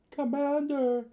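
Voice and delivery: high-pitched cry to their leader; soldiers calling out. A narrator's reading. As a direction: call out high like startled soldiers. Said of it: "the soldiers said".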